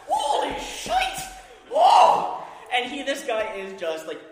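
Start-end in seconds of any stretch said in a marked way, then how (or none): 0.1-2.4 high, loud, slow whoops of victory; triumphant yell. Retold or imitated. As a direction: shout it like you just slew a god. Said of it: "guy number two is like".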